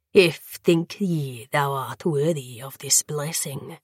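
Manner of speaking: soft, sneering question; hint of a cruel lord taunting the fledgling; mocking vampire lord. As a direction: bleak smile in the voice.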